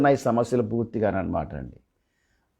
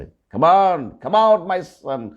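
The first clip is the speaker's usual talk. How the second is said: raised, higher commanding call; loud calling out; 5 semitones higher, 3 dB louder, much slower than usual. Retold or imitated. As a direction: call him out loudly, drawing out the words. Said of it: "గట్టిగా చెప్పాడు".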